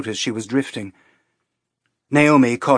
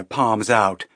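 plain reading voice

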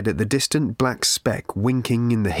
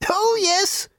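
put-on high, piping little old lady; piped cheerily. A narrator's reading. Old booth lady cheerily waving travellers on. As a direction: chirp it brightly like a tiny old woman. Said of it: "she piped cheerily".

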